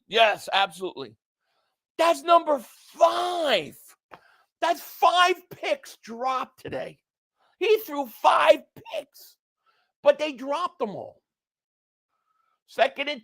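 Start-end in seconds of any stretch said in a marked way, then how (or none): 2.0-11.2 high, slow, drawn-out disbelief; exasperated, pitched-up amazement; incredulous. Real, not acted. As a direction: stretch the count out in real astonishment. Incredulous at five dropped picks.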